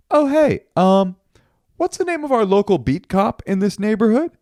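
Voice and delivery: high, innocent-sounding casual request; feigned casual cool. Retold or imitated. Man asking at the police station, feigned casual innocence. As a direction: pitch it high, all fake nonchalance. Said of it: "cool as a cucumber".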